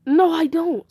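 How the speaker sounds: loud, high defiant outburst; defiant refusal. Acted. Boy refusing his team assignment, loud defiant refusal.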